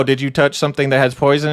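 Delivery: ordinary delivery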